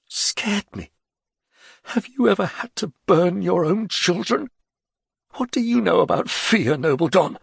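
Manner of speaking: put-on high, bitter old man; bitter Ger. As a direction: throw his fear back at him.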